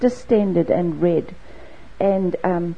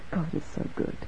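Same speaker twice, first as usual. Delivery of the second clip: hushed conviction; 4 semitones lower, 12 dB quieter than usual. Real, not acted. Intimate conviction.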